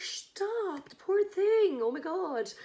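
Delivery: high, shocked cries of pity; pitying girls. Retold or imitated. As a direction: squeal with pity for the poor waitress. Girls pitying the fallen waitress.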